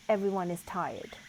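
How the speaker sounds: ordinary delivery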